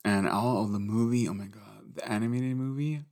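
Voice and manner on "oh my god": hushed awe. Real, not acted. Gushing about the movie.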